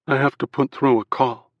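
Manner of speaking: a strained, off-kilter voice; odd tense voice. A narrator's reading. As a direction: sound unsettled and strange. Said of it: "Shep's voice sounded weird".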